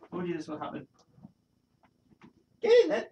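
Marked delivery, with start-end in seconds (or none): 2.6-3.1 much higher, louder burst of cheering; excited cheer. Real, not acted. Streamer cheering something happening live. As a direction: cheer it like a goal.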